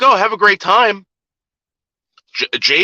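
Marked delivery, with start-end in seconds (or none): 0.0-1.0 high, cheery send-off; imitated easygoing wife. Friend's wife sending men to Hooters, mock cheerful. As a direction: play the agreeable wife, pitched up.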